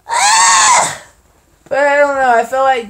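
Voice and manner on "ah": sharp high startled cry; loud startled shout; sudden yelp. Real, not acted.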